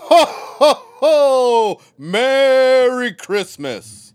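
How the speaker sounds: loud, hearty, slow Santa; a big, drawn-out jolly ho ho ho; Santa impression. Shown as a demonstration. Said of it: "[name], your turn".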